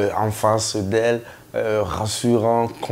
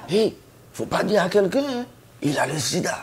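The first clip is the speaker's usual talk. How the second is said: mock gossip voice; 8 semitones higher than usual. Gossip passing on a secret.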